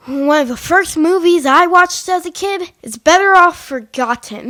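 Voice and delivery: loud, laughing mock title; loud joking. Real, not acted. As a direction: blurt out the fake title loudly. Mishearing the title, loud joke.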